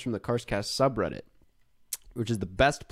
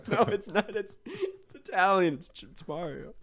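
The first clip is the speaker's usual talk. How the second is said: laughing; 10 semitones higher than usual. Real, not acted. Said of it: "that sounded like a Jamaican accent".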